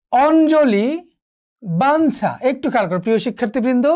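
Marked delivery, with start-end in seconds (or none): 0.1-1.1 অঞ্জলি drawn out slowly, ঞ as n; dental n sound. Shown as a demonstration. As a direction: hold a dental ন before জ in অঞ্জলি. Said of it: "দন্ত নয়ের মতো উচ্চারণ হবে". (অঞ্জলি টেনে, ঞ-কে ন-এর মতো উচ্চারণ)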